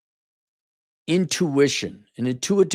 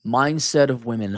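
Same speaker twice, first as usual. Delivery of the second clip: ordinary talk, nothing marked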